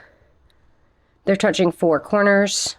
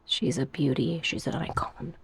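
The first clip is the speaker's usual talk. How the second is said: hushed gushing; 3 semitones lower, 11 dB quieter than usual. Real, not acted. Gushing over plant.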